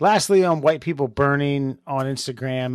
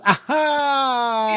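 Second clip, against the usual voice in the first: high, long, loud "aha"; demonstrated "ah"; 11 semitones higher, 4 dB louder than usual. Shown as a demonstration. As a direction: stretch out a high mocking "ahaaa". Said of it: "the ah was".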